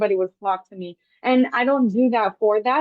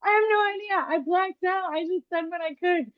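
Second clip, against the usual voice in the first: high-pitched, helpless mock confession; playfully exaggerated cluelessness in a high voice; retold flustered protest; 10 semitones higher than usual. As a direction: throw up your hands, voice pitched way up. Retells own flustered reply to family.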